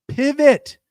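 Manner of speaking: high, loud yelled command; mock-urgent shout. Calling the room to switch contests, high mock-urgent shout. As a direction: yell it out high and hard.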